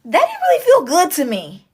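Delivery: high, loud mock-bewildered whine, slowing down; B Simone. Reader mimics B Simone reacting to good news, high and whiny. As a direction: squeal it, baffled and put out. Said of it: "like B Simone".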